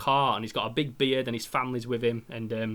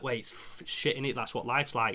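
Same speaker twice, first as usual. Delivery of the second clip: much softer, resigned voice; shrugging it off; 3 semitones lower, 8 dB quieter than usual. Retold or imitated. How he brushes off death, soft and resigned. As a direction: shrug it off quietly.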